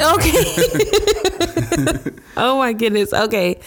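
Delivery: high breathy laugh into the words; real laugh, high and breaking; laughing through tears. Real, not acted. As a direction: laugh off being overwhelmed.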